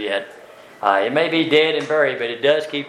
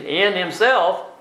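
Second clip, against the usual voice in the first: "in Himself" higher, very slow, weight on "in"; stress on in; 6 semitones higher, much slower than usual. Shown as a demonstration. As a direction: point out "in" by drawing it out. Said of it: "there it is again, the preposition".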